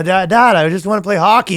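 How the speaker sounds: high mock-kid protest; pleading son. Son telling Dad his wish, high mock pleading.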